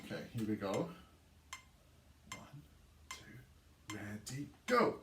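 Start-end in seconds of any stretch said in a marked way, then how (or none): 2.3-4.5 whispered count-in. Shown as a demonstration. Count-in before clapping along. 4.7-5.0 cue to start. Shown as a demonstration. Count-in before clapping along.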